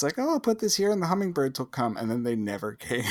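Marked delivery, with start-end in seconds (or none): 0.2-2.0 high, quick mom voice; mock chirpy mother; imitated hopeful mom. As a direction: play a naively hopeful mom, pitched up and rattling along. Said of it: "everybody's mom went out".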